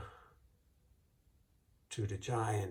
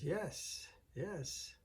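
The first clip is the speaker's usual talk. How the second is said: high, sugary, innocent voice; sweet innocent wife; 6 semitones higher, 4 dB quieter than usual. Acted. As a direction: play it sweet and harmless to fool him. Wife answering the giant.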